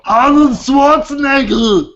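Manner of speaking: put-on loud, drawn-out Austrian accent; big slow Austrian impression; Arnold Schwarzenegger. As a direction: bellow it in a thick Austrian accent. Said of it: "say his name in his accent".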